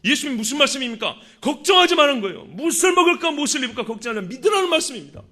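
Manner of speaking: forceful sermon delivery with emphatic quotations; impassioned preaching. Acted. Preacher quotes Jesus urging the congregation to believe, impassioned preaching. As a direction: drive the faith message home to the congregation.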